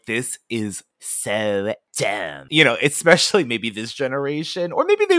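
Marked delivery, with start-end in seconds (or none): 0.0-2.4 bored scoff. Retold or imitated. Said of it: "I could totally see somebody going".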